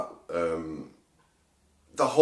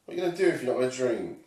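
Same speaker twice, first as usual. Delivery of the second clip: higher-pitched nagging question to oneself; raised, quizzing voice of inner fear; little inner voice; 8 semitones higher, faster than usual. Retold or imitated. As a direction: imitate a small doubting voice in the head. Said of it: "my little voice in my mind goes".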